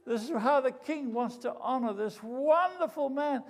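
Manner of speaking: high, loud crier's announcement; loud proclamation. Acted. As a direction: proclaim it to the crowd. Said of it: "call out in a loud voice".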